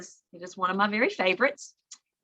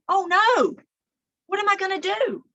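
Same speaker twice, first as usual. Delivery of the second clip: worried fox; 11 semitones higher, 5 dB louder, faster than usual. Acted. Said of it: "Fletcher's going".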